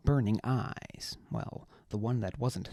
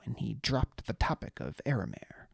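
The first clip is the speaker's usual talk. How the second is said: plain reading voice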